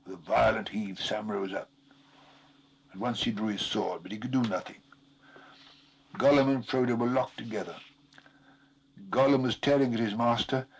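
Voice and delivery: ordinary delivery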